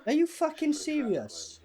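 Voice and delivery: high, drawn-out disbelief; incredulous. Real, not acted. Reacting to absurd pizza scene.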